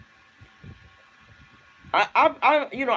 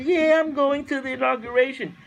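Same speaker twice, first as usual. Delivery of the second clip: a lightly voiced, higher, airy mock; higher put-on mocking voice; mocking Ivanka; 8 semitones higher than usual. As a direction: brush it off like a spoiled daughter. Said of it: "she said".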